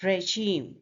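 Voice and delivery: "regime" as ray-ZHEEM, weight on the end; stress on second syllable. Shown as a demonstration. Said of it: "the stress is on the".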